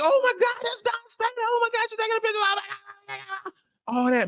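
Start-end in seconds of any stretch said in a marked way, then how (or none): 0.0-2.4 put-on high squealing girl voice; excited young girls. Young fan squealing to her friend on the court, very high and excited. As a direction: squeal it like a thrilled little girl. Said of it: "And like".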